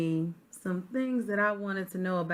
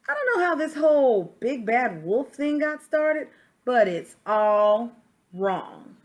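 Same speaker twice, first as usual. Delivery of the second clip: lively read-aloud as the wolf, a touch louder; story narration; 6 dB louder than usual. Acted. Reading a picture book to children, storyteller voice. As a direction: tell it like the wolf defending himself.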